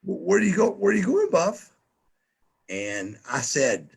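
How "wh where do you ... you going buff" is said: high, nagging mock voices of the crowd. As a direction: pitch it up like a teasing crowd. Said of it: "everybody's gonna go".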